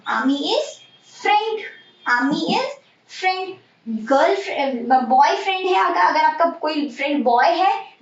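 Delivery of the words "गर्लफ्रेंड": ordinary talk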